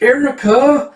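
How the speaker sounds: high, loud character voice; acted name call.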